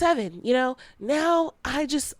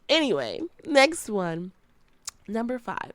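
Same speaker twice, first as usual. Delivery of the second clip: normal talking voice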